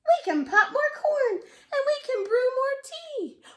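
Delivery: very high, loud squeaky mouse. Acted. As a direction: comfort the bear in a tiny squeak. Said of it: "Mouse squeaks".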